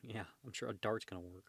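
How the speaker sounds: plain reading voice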